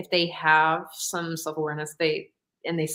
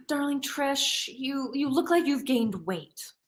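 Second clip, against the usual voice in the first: lightly voiced grandma, higher, slow; a hint of a doting old grandma; imitating grandma; 6 semitones higher, 4 dB quieter, slower than usual. Grandma telling her granddaughter about her weight, lightly voiced higher and slow. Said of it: "so grandma says".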